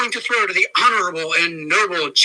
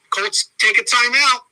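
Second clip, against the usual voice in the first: ordinary delivery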